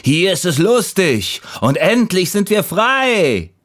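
high, exuberant call. A narrator's reading. Fallen leaves urging the last leaf, high joyful call. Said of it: "riefen die anderen".

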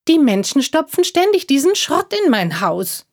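high squeaky squirrel voice; high little creature voice. Acted. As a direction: speak as the small squirrel, complaining. Squirrel explains the litter.

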